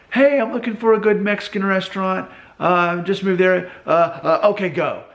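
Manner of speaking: high-pitched, scattered social media poster; acted flustered plea to everyone; chaotic. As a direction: blurt it out with mock urgency, stumbling on fillers. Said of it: "And then there's just all this chaos".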